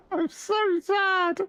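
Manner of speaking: very high, squeaked slow lament; drawn-out high mock wail; self-mocking despair. Real, not acted. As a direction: squeal it slowly, laughing at yourself. Laughing at own sadness.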